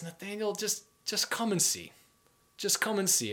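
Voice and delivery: gentle invitation. Retold or imitated. Philip gently inviting skeptical Nathanael to come, softly. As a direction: coax a doubting friend gently. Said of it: "Philip simply says".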